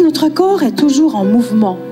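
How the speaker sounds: normal talking voice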